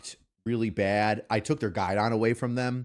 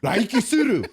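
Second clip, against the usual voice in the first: yelled Japanese; 8 semitones higher than usual. Retold or imitated. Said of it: "look at him and be like".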